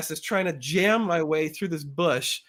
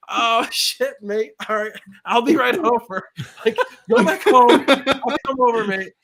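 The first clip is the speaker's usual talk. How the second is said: high, loud excitable mate; imitated neighbour; 7 semitones higher, 7 dB louder than usual. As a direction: call it out urgently, hammed up. Acts out male neighbour rushing help.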